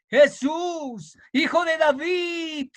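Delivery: high, drawn-out call; clamor a Jesús. Shown as a demonstration. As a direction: call out to Jesus, pleading. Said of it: "Y tú dile a Él". (clamor alto y prolongado)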